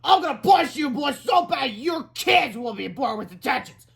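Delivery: high, loud threatening shout; furious yell. A narrator's reading. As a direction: threaten with full fury. Principal yelling threats.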